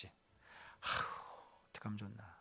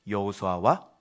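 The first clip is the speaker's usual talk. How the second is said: ordinary delivery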